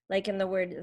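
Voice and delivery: ordinary talk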